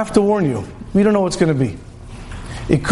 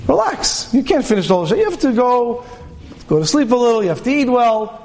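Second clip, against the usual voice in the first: coaxing Yetzer Hara, pitched high and sly; tempter voice; 4 semitones higher than usual. Acted. Evil inclination soothing an eager young man, high coaxing voice. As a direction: sweet-talk him into slowing down. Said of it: "the H R comes over to you and says".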